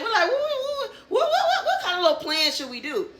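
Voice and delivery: high, giggly squeal, stuttering on the first word; excited plotting. Retold or imitated. Re-enacting excited plotting with laughter.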